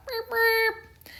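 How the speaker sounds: high playful bus horn beep; high mock beep. A narrator's reading.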